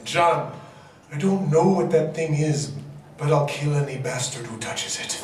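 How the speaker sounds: put-on low, gruff mystery accent as Jon; a confused attempt at some accent, low; unplaceable accent. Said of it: "what the hell accent".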